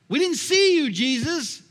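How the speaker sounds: high, loud mock protest; shocked protest. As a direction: cry out in astonished disbelief. The condemned protest to Jesus.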